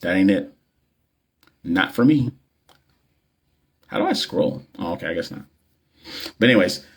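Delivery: ordinary talk, nothing marked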